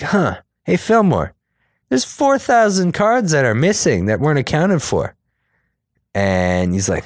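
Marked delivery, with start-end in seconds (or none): 0.0-5.1 high mock voice. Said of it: "They're like".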